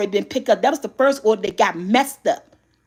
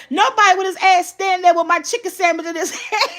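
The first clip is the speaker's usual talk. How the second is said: laughing; 6 semitones higher than usual. Retold or imitated. Repeats the son's line laughing.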